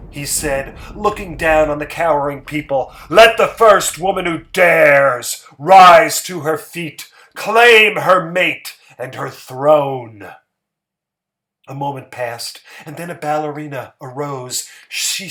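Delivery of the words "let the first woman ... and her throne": loud, slow royal command; commanding challenge. A narrator's reading. Harrison daring the women of the crowd to rise, loud and slow. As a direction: dare them to rise, booming.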